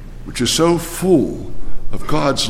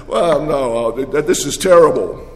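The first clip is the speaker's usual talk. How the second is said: normal talking voice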